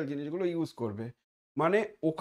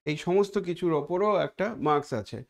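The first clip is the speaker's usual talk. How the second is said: usual speaking voice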